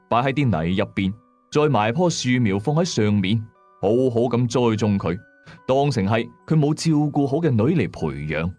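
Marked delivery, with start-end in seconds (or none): none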